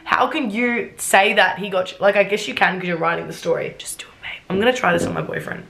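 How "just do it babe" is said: hushed, voiceless whisper; very soft whispered line; whispered aside. Acted. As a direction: whisper it under your breath. Murmured aside amid reading.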